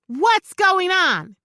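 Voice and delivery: slow, loud, stern demand. Acted. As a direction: demand an answer. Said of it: "she demanded".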